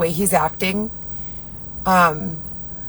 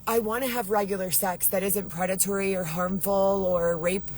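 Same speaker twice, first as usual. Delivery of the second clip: usual speaking voice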